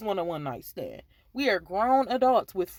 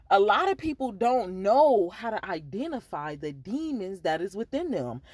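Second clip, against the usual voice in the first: ordinary talk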